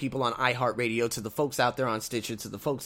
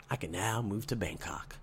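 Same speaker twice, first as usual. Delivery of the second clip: much lower and softer, savoring it; 7 semitones lower, 12 dB quieter than usual. Shown as a demonstration. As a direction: say it quietly, savoring it.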